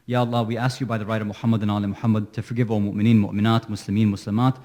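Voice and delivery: low, very fast formula prayer; quick ritual Arabic recitation; rapid dua recitation. Acted. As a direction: rattle off the closing prayer. Closing supplication rattled off.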